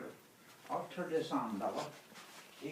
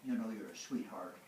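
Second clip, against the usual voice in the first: cooing to a dog; 6 semitones lower, 5 dB quieter than usual. Real, not acted.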